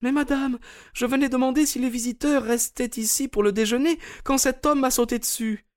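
high-pitched protesting maid's voice; complaining maid. Acted. Narrator voices the maid Suzanne.

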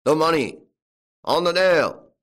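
loud, high bellow; hard, insistent shout; shouted demand. A narrator's reading. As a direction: bellow it at the tramp. Farmer demanding money from the tramp.

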